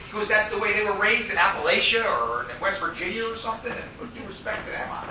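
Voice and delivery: ordinary delivery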